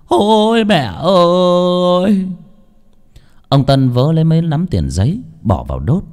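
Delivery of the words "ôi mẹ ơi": higher, very slow drawn-out cry; wailing lament. Acted. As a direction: stretch "mẹ ơi" as a sob. Son wailing beside mother's coffin. (rên rỉ than khóc kéo dài)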